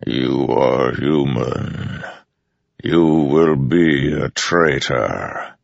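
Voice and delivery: very slow, immense godlike voice; Storm Father's voice. A narrator's reading. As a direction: let each word roll like distant thunder.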